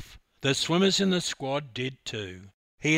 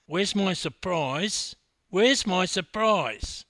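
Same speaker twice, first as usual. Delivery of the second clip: loud, high, drawn-out demand; impatient pestering; 7 semitones higher, 6 dB louder, slower than usual. Acted. Swimmer interrupting the team meeting, impatient pestering. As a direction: nag insistently, stretching each word. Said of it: "anxious for his surprise and interrupting".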